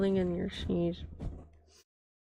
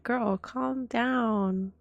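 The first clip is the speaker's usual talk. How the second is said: slow exasperated; 4 semitones higher, much slower than usual. Retold or imitated. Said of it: "I'm like".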